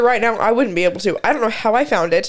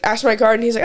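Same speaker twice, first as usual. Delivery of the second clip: normal talking voice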